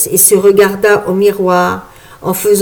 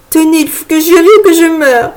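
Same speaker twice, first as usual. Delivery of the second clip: high, merry outburst; helpless mirth; 7 semitones higher than usual. A narrator's reading. Marguerite apologising to the fairy, overcome with mirth. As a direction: give way to acted hilarity. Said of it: "elle fut prise d'un fou rire".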